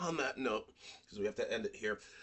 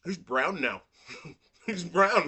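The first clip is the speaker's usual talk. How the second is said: laughing joke; 7 semitones higher, 9 dB louder, slower than usual. Real, not acted.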